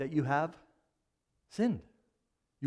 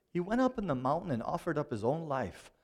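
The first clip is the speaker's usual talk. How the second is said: ordinary talk, nothing marked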